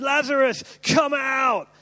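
loud, high call of Lazarus; shouted command. A narrator's reading. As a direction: cry it out into the tomb. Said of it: "And Jesus shouted".

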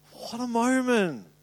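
slow, wondering repeat; awed hush. Real, not acted. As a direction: let it sink in with awe. Repeated in awe, slowed.

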